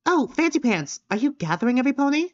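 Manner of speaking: character voice. Acted. Voicing character to Fancy Pants.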